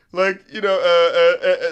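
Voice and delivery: usual speaking voice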